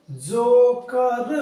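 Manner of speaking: जोकर drawn out very slowly, long ओ; slow demo reading. Shown as a demonstration. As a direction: stretch जोकर for the learners. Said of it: "ओ कहाँ पे लगा?". (जोकर को धीरे-धीरे खींचकर)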